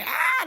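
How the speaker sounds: short high-pitched imitated scream; mock scream. Youngest daughter learning the man is dead, mock scream. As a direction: let out a brief put-on shriek. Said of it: "[name] get to start screaming".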